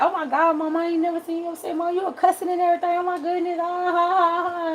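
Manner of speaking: put-on high laughing teen voice quoting mom; daughter's amazed voice. Daughter teasing her mom, high and laughing. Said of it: "She was like".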